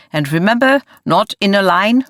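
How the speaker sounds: lightly voiced German foreman calling out; German accent. A narrator's reading. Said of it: "His accent became more pronounced".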